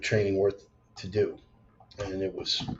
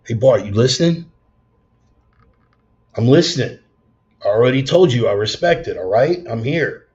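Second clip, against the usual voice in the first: loud playful back-and-forth; mock exchange; 2 semitones higher, 7 dB louder than usual. Nudging himself to keep listening, loud and playful. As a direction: tease yourself then answer back.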